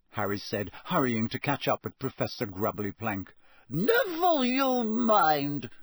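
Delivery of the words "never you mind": high, slow, dismissive teacher. Acted. Teacher brushing off Harry's nosy question, dismissive and slow. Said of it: "as though she thought he was being nosy".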